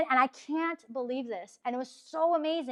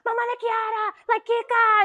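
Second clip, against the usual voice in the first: much higher, loud, starstruck squeal; starstruck gushing; 10 semitones higher, 8 dB louder than usual. Retold or imitated. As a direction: gush like a starstruck fan. Starstruck on meeting saint's mom.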